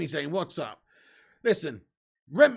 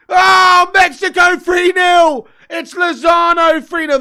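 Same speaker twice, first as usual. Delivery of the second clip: real shout, much higher and louder, slow on the score; excited shout; 10 semitones higher, 16 dB louder, slower than usual. Real, not acted. Streamer watching live as Mexico score, shouting. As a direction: yell the goal out at full power.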